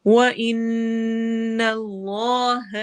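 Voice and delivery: slow chanted tajweed with a long drawn ha; Quranic recitation. Shown as a demonstration. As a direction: recite it, stretching the vowel. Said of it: "جلالہ کو heavy پڑھیں گے".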